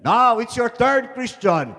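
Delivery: loud, high taunt dropping at the end; calling out challenge. Acted. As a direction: call your rival to jump.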